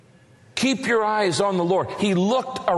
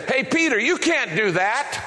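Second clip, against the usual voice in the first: mocking doubter; 6 semitones higher than usual. Doubter calls from the boat.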